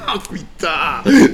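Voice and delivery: slow laughing swear; laughing exclamation. Real, not acted. Laughing excited outburst.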